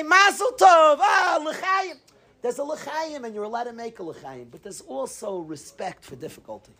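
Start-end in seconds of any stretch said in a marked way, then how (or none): 0.0-2.0 high, exclaimed congratulations; jubilant toast. Acted. Imagined messenger cheering good tidings, jubilant. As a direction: call out a festive toast, pitched way up. Acted festive toast he says wasn't made.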